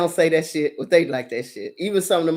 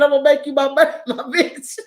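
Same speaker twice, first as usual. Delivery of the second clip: quoting [name]; 6 semitones higher than usual. Retold or imitated. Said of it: "When [name] said".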